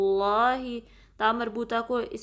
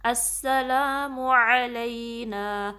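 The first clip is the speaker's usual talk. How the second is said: very slow, drawn-out recitation of the greeting; much slower than usual. Shown as a demonstration. Teacher reciting the phrase for students to repeat. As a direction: stretch each vowel in a measured chant. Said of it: "آئین کو درمیانے حلق".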